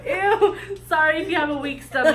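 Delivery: high disgusted laughing ew. Real, not acted. Laughing at the story.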